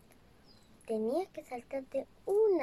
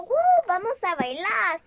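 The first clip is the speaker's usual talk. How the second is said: excited call; 4 semitones higher, 6 dB louder than usual. Acted. Elephants going to dance.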